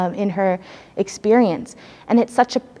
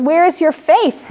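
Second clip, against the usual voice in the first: higher, louder, slow rebuke; challenging call; 8 semitones higher, 4 dB louder, slower than usual. Retold or imitated. Teresa chides her sisters, raised slow voice. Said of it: "Teresa says".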